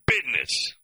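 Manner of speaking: "business" said as "bidness"; BID-ness for "business", Southern style. Shown as a demonstration. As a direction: put a d in "business" where the z goes. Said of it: "not business. Business".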